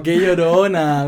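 slow, drawn-out teasing; lingering mock reproach, slowly stretched; different, drawn-out tone. Shown as a demonstration. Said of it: "porque es distinto".

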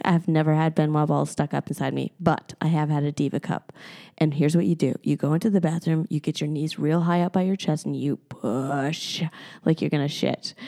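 low, soft, earnest instructions; imitating serious advice. Friend giving step-by-step advice by text, mock serious. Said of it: "she got so serious".

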